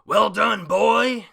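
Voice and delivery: huge booming shout; deafening villain yell; villain scream. Retold or imitated. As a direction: bellow it like a gloating villain.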